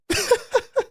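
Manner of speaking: a burst of giddy laughter; excited high laugh. Real, not acted. Fan laughing at the Eagles' big win. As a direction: let a delighted laugh break out.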